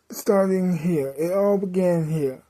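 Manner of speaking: normal talking voice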